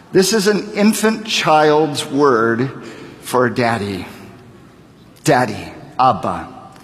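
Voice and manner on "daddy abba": ordinary talk, nothing marked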